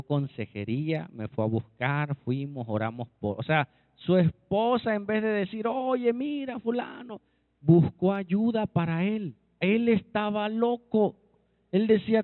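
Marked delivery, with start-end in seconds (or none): 5.7-7.2 high-pitched imagined telling-off; raised pitch, as if complaining about him; mock complaint. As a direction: act out a wife calling him out. Wife's hypothetical complaint, acted out.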